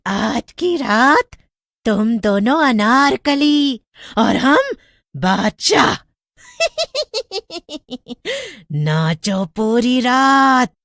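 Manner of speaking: high, slow mocking witch voice; taunting witch. A narrator's reading. Witch mocking the boys, ordering them to dance all night. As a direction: drag the words out to taunt them.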